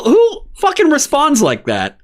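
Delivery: high-pitched exasperated disbelief; incredulous. Real, not acted. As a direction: protest it in a high, baffled voice. Incredulous rant at stagey acting.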